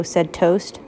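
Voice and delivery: ordinary delivery, nothing marked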